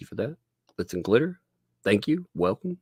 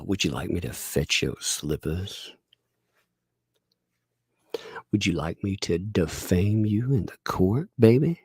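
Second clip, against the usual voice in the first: sexy voice. Shown as a demonstration. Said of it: "Can you do sexy voice?".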